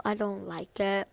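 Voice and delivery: usual speaking voice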